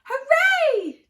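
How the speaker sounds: high, loud joyful cheer. Acted. Danny cheering on landing home, loud. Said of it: "Danny cried".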